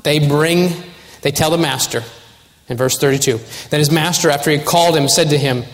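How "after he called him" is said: ordinary delivery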